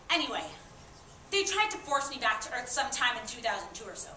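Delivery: ordinary delivery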